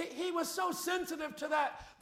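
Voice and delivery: ordinary talk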